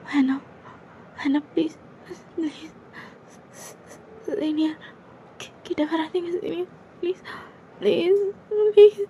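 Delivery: put-on panicked pleading girl. Junior begging her senior again and again, rising panic. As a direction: keep begging, voice climbing.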